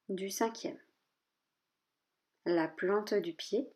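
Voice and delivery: measured body-scan list, a pause after each part; name each body part evenly, leaving space; calm yoga nidra guidance, short spaced phrases; guided meditation. Real, not acted.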